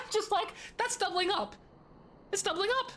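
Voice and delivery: high-pitched laughing self-quote. Retold or imitated. Retelling own thought, laughing.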